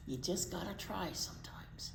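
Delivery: soft breathy aside; whispered moral. Real, not acted. Reader to children after the story, whispered moral. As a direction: hush it like a secret.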